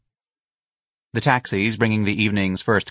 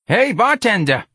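loud, high call across the bar; 6 dB louder than usual. A narrator's reading. Missus Riley calls across the bar to the bartender, loud. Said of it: "Missus Riley called".

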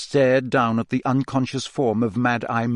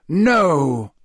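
sharp, raised refusal; 4 semitones higher, 5 dB louder than usual. A narrator's reading. Dumbledore refuses to let Harry go, sharply. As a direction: cut in with a firm, louder word. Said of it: "said Dumbledore sharply".

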